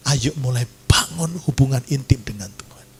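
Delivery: usual speaking voice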